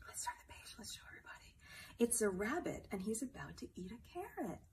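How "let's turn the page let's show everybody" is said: hushed page-turn whisper. Acted. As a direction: whisper it to the little one.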